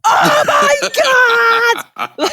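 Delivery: very high, loud mock shriek; astonished shout. Viewer reacting to the mother-and-son twist, astonished shriek. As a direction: shriek it in hammed-up disbelief.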